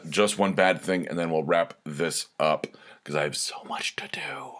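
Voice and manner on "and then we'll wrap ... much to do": whisper. Real, not acted. Hurried aside while wrapping up.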